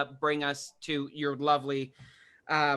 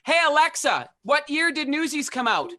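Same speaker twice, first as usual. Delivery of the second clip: raised, higher voice to a device; calling out; 10 semitones higher, 6 dB louder than usual. Real, not acted. Asking Alexa when Newsies came out, calling out. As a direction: call out clearly so the assistant hears.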